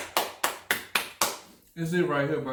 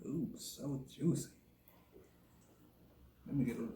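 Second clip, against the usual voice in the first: soft unvoiced whisper; 11 dB quieter than usual. Real, not acted. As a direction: breathe it out like ASMR. Whispering over food.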